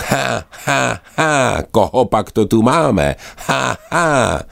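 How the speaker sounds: high, cackling gull voices; jeering gulls. Acted. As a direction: squawk it out like a mocking gull. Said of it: "křičeli racci jeden přes druhého". (vysoký posměšný racčí chechot)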